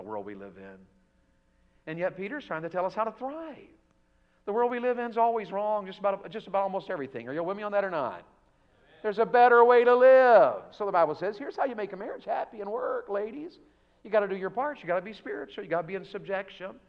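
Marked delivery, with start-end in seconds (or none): none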